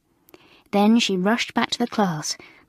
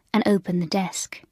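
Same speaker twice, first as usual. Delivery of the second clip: ordinary delivery, nothing marked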